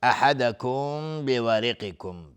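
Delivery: slow "احدکم" with a hummed m held into "بورقکم"; labial ikhfa. Shown as a demonstration. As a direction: hum the end of "احدکم" into the b. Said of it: "میم ساکن بهش با اخفای شفوی".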